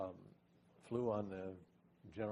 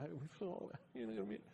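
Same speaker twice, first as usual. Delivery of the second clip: high, whiny near-crying plea; mock tearful whine, much higher; hurt puppy whimper; 12 semitones higher than usual. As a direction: beg like a wounded puppy. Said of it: "I was just cry".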